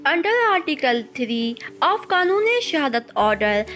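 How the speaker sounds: ordinary talk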